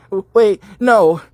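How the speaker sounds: put-on high, loud girly stammer; Valley Girl voice. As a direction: play a squeaky Valley Girl begging. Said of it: "that Valley Girl voice".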